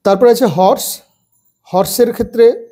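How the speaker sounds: normal talking voice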